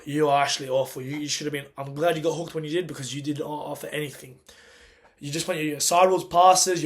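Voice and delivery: normal talking voice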